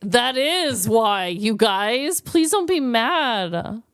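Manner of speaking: ordinary talk, nothing marked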